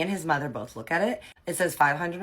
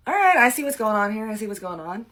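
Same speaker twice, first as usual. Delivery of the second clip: caught out; 7 semitones higher, 7 dB louder than usual. Retold or imitated. Ex caught in an ambush.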